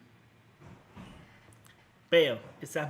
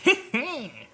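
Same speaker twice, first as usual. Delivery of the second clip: burst of laughter; 8 semitones higher, 7 dB louder than usual. Real, not acted. Laugh after the line.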